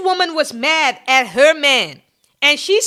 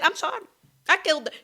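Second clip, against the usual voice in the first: softer, offhand "I'm sorry, I killed her"; mock flat apology; 3 semitones higher, 7 dB quieter, much faster than usual. Vlogger mimicking the killer's casual confession, softer. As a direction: shrug it off like it's done. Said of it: "And she just said".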